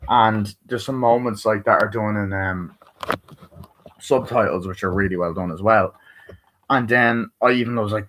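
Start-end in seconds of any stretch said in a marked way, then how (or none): none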